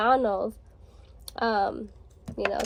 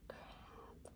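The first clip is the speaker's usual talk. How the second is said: breathless whispered crap; whispered curse; 30 dB quieter than usual. Retold or imitated. Hiding in the stall from her mom, a whispered curse. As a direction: whisper it so mom in the bathroom won't hear.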